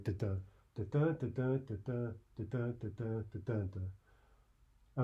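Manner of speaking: chanted "da-da", each second "da" stressed; iambic rhythm. Shown as a demonstration. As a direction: beat out "da-da" evenly, weak then strong. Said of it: "an iamb is, of course".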